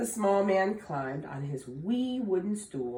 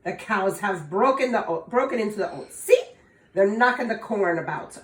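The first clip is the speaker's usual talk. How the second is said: put-on louder, fast, snappish old fairy; sharp leprechaun; 3 semitones higher, 7 dB louder, faster than usual. Leprechaun sharply scolding young Tom, louder and fast. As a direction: snap back to get rid of him. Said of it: "answered Tom sharply".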